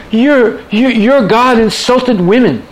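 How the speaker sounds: high-pitched, slow mock protest; outraged heckler. Women's liberation advocate objecting to the verse, high-pitched mock outrage. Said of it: "advocate started screaming".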